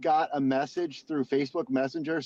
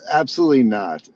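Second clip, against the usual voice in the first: loud emphatic; 11 dB louder, faster than usual. Real, not acted. Responding emphatically.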